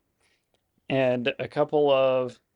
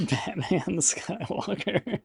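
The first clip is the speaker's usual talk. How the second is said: laughing; 6 semitones higher, 3 dB quieter, much slower than usual. Real, not acted. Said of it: "laughing repeat of joke".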